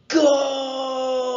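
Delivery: high, loud goal roar; roaring cheer. A narrator's reading. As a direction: roar it like a football fan. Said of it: "roared Mohammed and Angus".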